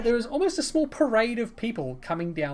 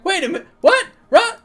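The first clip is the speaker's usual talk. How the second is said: high, loud double-take; baffled; 13 semitones higher, 9 dB louder than usual. Acted. Rock seeing Ryla where he didn't expect, baffled yelp. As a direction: blurt it out in loud confusion.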